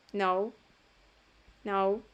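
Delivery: "No" closing on a U sound. Shown as a demonstration. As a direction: model "No" as "nou", ending rounded. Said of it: "lo lees como una U". ("No" terminando en sonido de U)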